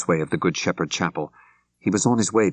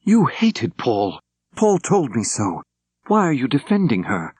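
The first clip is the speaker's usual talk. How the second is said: accusing; 6 semitones higher than usual. Acted. Accusing rival in chapel.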